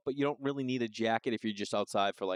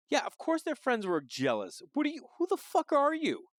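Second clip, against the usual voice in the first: mock incredulous rant; 11 semitones higher than usual. Host mocking Jen's birthday cake tradition, high incredulous rant. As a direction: rant in amused disbelief.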